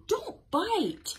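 high-pitched storybook scolding; high, indignant mock complaint; protesting bunny. A narrator's reading.

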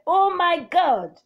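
loud, high, drawn-out outcry with a final fall; exclamation. Shown as a demonstration. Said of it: "Exclamation and interjection with a falling tone".

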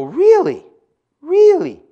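a sharp, high disbelieving really, building; mock disbelief. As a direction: play the stunned skeptic. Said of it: "You go".

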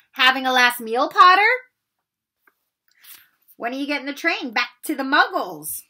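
loud, high mocking jeer; a bully's loud taunt across the table; sneering taunt. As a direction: jeer at him like a schoolyard bully. Malfoy taunting Harry at dinner.

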